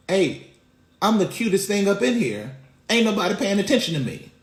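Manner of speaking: put-on indignant toddler. Toddler son wanting everyone's attention, lightly voiced and indignant. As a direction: put a hint of pushy little kid in it.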